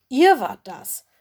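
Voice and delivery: sharp accusing outburst; angry growl. A narrator's reading. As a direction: burst out in angry disbelief. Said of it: "Mino knurrte böse".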